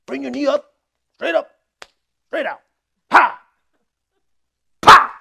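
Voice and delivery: drill instructor. Retold or imitated. Instructor drilling recruits on the front kick, loud and high. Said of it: "he's like".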